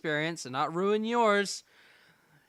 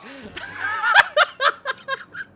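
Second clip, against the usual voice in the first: high, loud burst of laughter; 9 dB louder than usual. Real, not acted. Player laughing at the game's blinding, loud high cackle. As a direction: crack up loudly at the absurdity.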